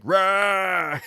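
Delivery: loud high mock roar; monster roar. Monsters roaring at the doctor, mock roar. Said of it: "they're just like".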